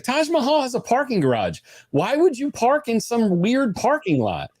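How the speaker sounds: high, incredulous interrogation; exasperated wife. Retold or imitated. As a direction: imitate a wife who isn't buying it. Wife interrogates husband about parking.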